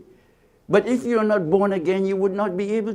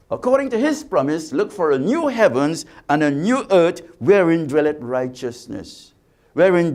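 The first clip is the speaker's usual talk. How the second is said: loud, ringing scripture proclaimed to the congregation; preaching at full force; 4 dB louder than usual. Real, not acted. Preacher warning the congregation of judgment, loud and ringing. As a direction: thunder the verses out like a pulpit preacher.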